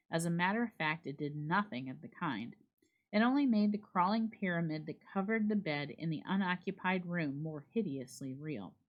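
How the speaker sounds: ordinary delivery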